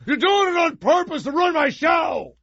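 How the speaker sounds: put-on high, shrill-pitched yell of an angry kid; kid shouting. Boy shouting at brother about ruined show, high shout. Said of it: "that's what I would say".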